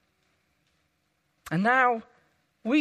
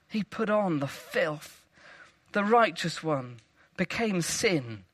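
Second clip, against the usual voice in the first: ordinary talk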